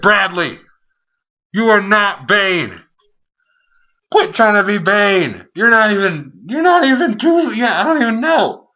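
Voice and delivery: high, loud, drawn-out mock telling-off; exasperated scolding. Reviewer ordering Maddox to quit being Bane, exasperated scolding. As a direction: scold him loudly, pitch climbing in disbelief.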